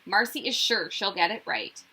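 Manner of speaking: plain reading voice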